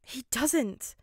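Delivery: high, loud, curt retort; bitten out. A narrator's reading. Boy snapping back at his classmate, bitter. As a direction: bite the words off sharply. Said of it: "Midoriya bit out".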